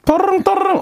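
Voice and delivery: high sing-song ring-ring; phone ringing imitation. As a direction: chime 따르릉 like a phone. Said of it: "상황극 했던 것처럼".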